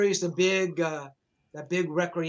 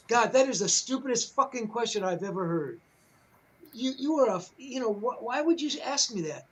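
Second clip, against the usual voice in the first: higher, scornful rant; contemptuous put-down; 6 semitones higher than usual. Pretend. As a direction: sneer at a stupid question, pitched up. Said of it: "like Ed saying".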